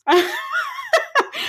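high squeal of a laugh. Real, not acted. Laughing at Leah's smugness, high squealing laugh.

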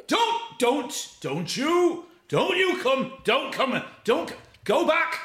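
high, halting yelled plea; retold shouting. Friend warns comedian away from the bush, high desperate shouting. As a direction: mock-desperate shout, breaking off and restarting. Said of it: "he just kept shouting".

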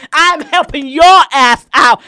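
loud, high, slow retort; seeing red. Retold or imitated. Retail worker setting a rude customer straight, angry. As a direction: angrily stretch it out at the customer, acted. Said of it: "I saw red".

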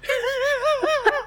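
sudden high-pitched giggle. Real, not acted. Host laughing at the odd tradition, high giggle. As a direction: burst into a squeaky laugh.

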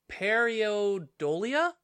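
PERIODOLIA sounded out slowly with a questioning rise; tentative, drawn-out attempt at "periodolia"; unsure pronunciation. Real, not acted. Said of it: "I'm gonna butcher it".

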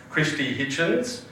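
normal talking voice

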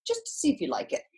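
high, coaxing imitation English accent; bad English accent. Agent talking her into a week at Wimbledon, imitated English accent. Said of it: "the worst English accent I've ever".

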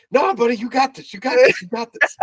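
high-pitched mock supportive mom; full mom encouragement. Imitating friend reassuring a nervous learner driver, high mom-like cheering. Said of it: "he was full mom".

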